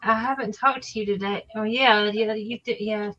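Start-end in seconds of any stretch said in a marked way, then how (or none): none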